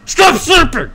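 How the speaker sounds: very loud, high mock-furious scream; explosive imitated scolding yell. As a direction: scream it right in someone's face. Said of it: "gonna scream at my face".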